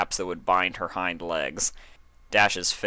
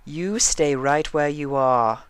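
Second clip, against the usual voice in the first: higher, slow, cool warning; almost icy; 8 semitones higher, 5 dB louder, slower than usual. A narrator's reading. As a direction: freeze her in place with calm authority. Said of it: "Rarity's voice was almost icy".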